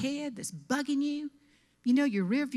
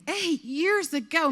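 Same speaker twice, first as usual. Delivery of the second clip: incredulous echo; 6 semitones higher, 4 dB louder, slower than usual. Retold or imitated.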